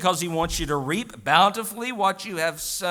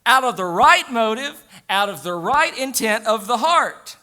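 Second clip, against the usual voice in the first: raised, higher preaching voice; full-force preaching; 7 semitones higher, 8 dB louder than usual. Real, not acted. Pastor urging cheerful giving from the heart, preaching hard. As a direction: ring it out to the congregation.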